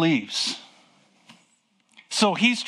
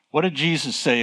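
ordinary talk, nothing marked

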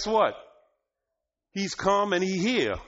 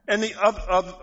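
ordinary talk

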